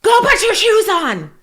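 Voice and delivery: high, loud, slow shouted command; yelled order. Shown as a demonstration. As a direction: bark it at the kids like a fed-up parent. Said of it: "So instead of".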